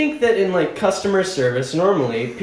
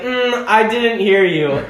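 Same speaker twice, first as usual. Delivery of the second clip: clerk not hearing; 7 semitones higher, 4 dB louder, slower than usual. Retold or imitated. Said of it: "And then he's like".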